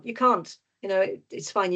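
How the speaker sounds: normal talking voice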